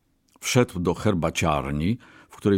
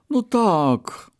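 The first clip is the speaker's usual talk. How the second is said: high-pitched, halting agreement; concede, with a 'but' coming; hesitant concession; 12 semitones higher than usual. A narrator's reading. Brahmin concedes to the merchant, hesitant.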